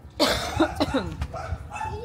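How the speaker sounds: real dry hacking cough; a harsh dry cough from the smoke. Real, not acted. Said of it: "very dry ka".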